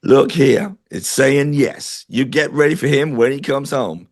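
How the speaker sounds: slow, drawn-out imitation of a deep Southern US accent. Medium retelling a Southern pendulum reader's answer, in a mock Southern US accent. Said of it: "in her deep southern accent".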